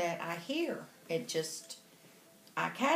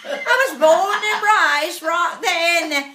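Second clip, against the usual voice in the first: high, loud put-on English accent; mimicked English accent; 8 semitones higher, 9 dB louder than usual. Telling the lady about the Texas Panhandle, loud English accent. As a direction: declare it grandly in a fake English accent. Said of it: "we're talking like this the whole time".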